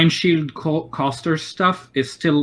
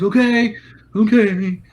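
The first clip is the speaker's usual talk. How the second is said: put-on young kid; 8 semitones higher, 3 dB louder than usual.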